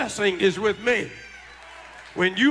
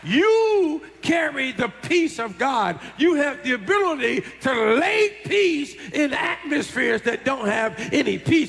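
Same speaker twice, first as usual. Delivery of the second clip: high, ringing preacher's cry; full-force preaching; 5 semitones higher than usual. Acted. Preacher to congregation, rising full-force preaching. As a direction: lift the church with it.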